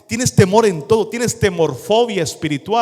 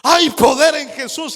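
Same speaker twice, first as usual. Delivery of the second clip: high, squealing panicky cry; panicked cry; 9 semitones higher, 3 dB louder than usual. Retold or imitated. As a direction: squeal it like a grown man scared of a bug. Man panics over a cockroach. (grito de pánico agudo)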